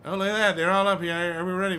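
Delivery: loud, high and excited. Real, not acted. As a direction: pump up the start of the match. Excited at match start.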